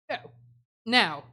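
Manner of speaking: a sudden crack in the voice. Real, not acted. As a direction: voice cracks on the word. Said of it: "my voice just cracked there".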